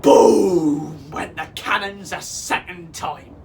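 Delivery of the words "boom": loud, long-drawn cannon blast; big storybook boom; booming sound word. A narrator's reading. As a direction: stretch it like a cannon firing.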